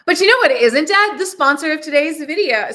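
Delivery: fast, high, loud hype host; YouTuber voice. Pretend. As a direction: pitch the sponsor like a YouTuber. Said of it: "YouTuber voice".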